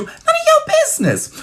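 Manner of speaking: high, squeaky cartoon sass; a little nugget telling grown-ups off; dino nugget voice. Nugget translation snapping back at Mom and Dad, squeaky high. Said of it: "which directly translates into".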